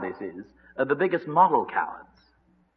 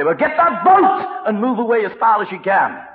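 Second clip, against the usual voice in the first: high, loud command; acted urgent shout; urgent order; 7 semitones higher, 6 dB louder than usual. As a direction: cry out to get away. Imagined Peter urging escape.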